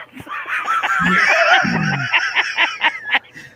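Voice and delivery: laughing so hard it goes high; long laughing fit. Real, not acted. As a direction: lose it completely. Laughing after calling for quiet.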